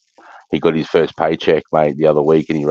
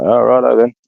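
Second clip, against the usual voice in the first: high, louder "oh, righto then" as if taken aback; mock surprised go-along; 8 semitones higher, 4 dB louder than usual. Retelling his reply to a mate, mock surprised and higher. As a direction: act caught off guard but happy to go along. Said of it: "And I'm like".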